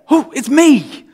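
hammed-up jump-out surprise; mock overexcited reveal. Preacher mocks a showy Jesus jumping out at Mary, overexcited. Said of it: "he doesn't jump out at her and say".

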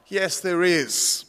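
slow, calm voice from the sky; calm voice of God. Acted. God answering the dangling tourist, slow and calm. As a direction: speak slowly from above, unhurried. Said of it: "A calm, powerful voice came out of the sky".

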